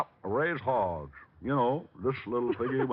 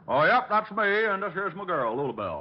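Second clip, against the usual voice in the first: hillbilly put-on; 6 semitones higher, 3 dB louder than usual. Detective in disguise calling the psychic, country accent. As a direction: play the hog farmer yokel.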